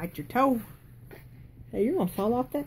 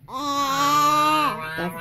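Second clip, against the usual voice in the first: crying; 5 semitones higher, 5 dB louder than usual. Real, not acted. Child cries after prompt.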